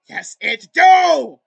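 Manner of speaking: slow, very loud furious shout. Acted. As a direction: bellow it out in outrage. Acted dog-owner argument.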